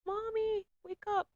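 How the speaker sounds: put-on high, soft little girl's voice calling from far away; little daughter calling. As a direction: call softly like a small child waking her mom. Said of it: "I hear her call me".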